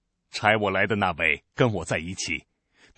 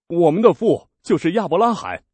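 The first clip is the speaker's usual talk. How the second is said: raised, higher, louder protest; indignant crowd; 7 semitones higher, 7 dB louder than usual. Acted. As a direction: insist hotly on their lineage. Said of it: "他们说".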